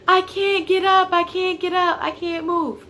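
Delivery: high, loud mock of mom yelling. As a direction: imitate mom shouting she can't get up. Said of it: "just yelling at me through the door".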